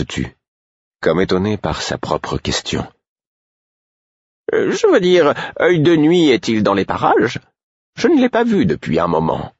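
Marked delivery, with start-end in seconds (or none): 4.5-9.5 put-on a worried old woman covering her slip; voiced old woman Caudron. A narrator's reading. Caudron asking Fitz about NightEyes, higher, uneasy voice.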